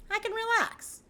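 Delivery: high airy I can relax; high put-on carefree voice; mock relief. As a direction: sing it out lightly, joking.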